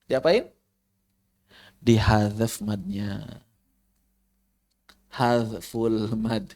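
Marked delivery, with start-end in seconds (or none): 1.8-6.4 slow, low, careful dictation; slow deliberate term. Shown as a demonstration. Teacher dictates a new term slowly and carefully. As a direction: spell out the term slowly for students. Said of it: "teacher spells out the term".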